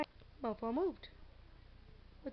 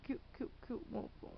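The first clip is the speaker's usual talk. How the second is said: whisper; 5 semitones lower, 9 dB quieter than usual. Real, not acted. Whispering to the cat.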